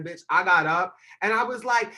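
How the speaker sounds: normal talking voice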